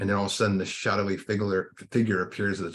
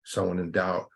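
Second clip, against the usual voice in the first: guided meditation. Acted. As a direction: guide them gently inward, taking your time.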